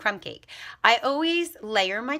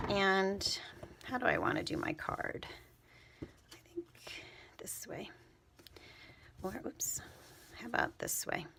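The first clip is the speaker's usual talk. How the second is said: musing in a whisper; 10 dB quieter than usual. Real, not acted. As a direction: whisper your thoughts aloud. Deciding the ribbon while working.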